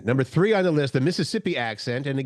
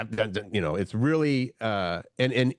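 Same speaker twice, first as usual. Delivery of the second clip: normal talking voice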